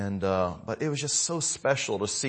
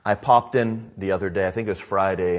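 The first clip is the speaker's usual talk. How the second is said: ordinary talk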